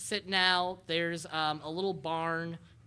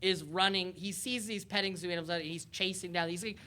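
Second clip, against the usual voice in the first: ordinary talk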